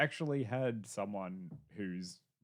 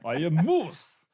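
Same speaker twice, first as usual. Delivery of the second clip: slow, louder, hammed-up cartoon Russian accent; comic Russian-accented declaration, Boris and Natasha style; 5 semitones higher, 7 dB louder, slower than usual. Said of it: "very Boris and Natasha sounding".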